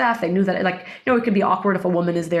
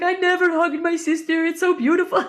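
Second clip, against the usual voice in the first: higher, lightly teary imitation; mock tearful man; 10 semitones higher than usual. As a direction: play a moved stranger, teary and tender. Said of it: "he has tears streaming down his face".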